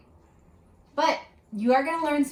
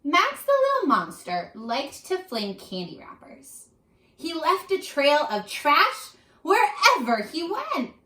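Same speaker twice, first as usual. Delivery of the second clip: lively storybook read-aloud, louder; reading to children; 6 semitones higher, 5 dB louder than usual. Acted. Teacher reading a picture book aloud to her class. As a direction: paint each line for young listeners.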